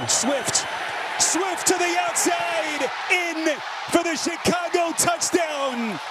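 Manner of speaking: drawn-out shouted touchdown call; excited play-by-play. Real, not acted. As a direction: stretch the call as he scores.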